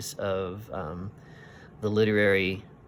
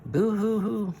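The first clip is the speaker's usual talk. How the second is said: high wailing boo-hoo, much higher; mock child crying; 13 semitones higher, 4 dB louder, slower than usual. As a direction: wail like a little girl in tears. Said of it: "That sounds like a child crying".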